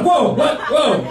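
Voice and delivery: high, laughing mock surprise; playing along, startled; mock shocked whoa.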